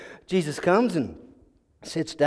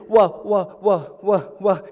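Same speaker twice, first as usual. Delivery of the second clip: repeated stammering false starts; nervous stalling; much slower than usual. Acted. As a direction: stall anxiously, stuck on one word. Nervous advisor afraid to answer.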